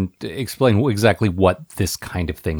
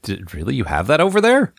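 surprised; 4 semitones higher than usual. Retold or imitated.

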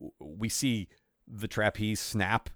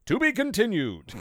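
dramatic announcer; 10 semitones higher, 3 dB louder than usual. Acted. Mock cliffhanger announcer.

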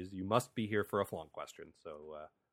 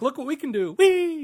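high, giddy mock excitement; rising to a loud, high whee; gleeful show-off; 7 dB louder than usual. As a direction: squeal it like a kid showing off. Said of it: "the first season was like".

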